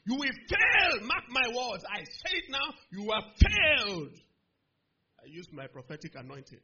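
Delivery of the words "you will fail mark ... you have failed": loud, drawn-out pronouncement of doom; angry curse. Retold or imitated. Student cursing the bully with prophetic anointing, loud and angry. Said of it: "that curse we just came from".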